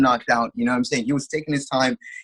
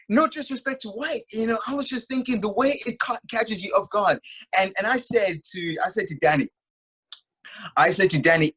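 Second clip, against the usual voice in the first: usual speaking voice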